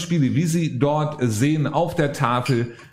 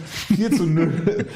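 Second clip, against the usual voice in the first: laughing; 3 semitones higher, much slower than usual. Real, not acted. Slip over the score, laughing.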